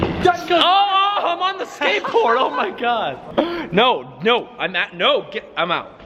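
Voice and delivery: high, shouted, startled; startled yelp. Real, not acted. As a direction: yelp in sudden fright. Startled on skateboard.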